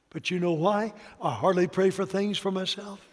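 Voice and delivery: normal talking voice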